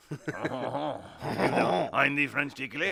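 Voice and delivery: hammed-up French accent. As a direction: play a comic French seducer with the accent. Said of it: "my accent changes".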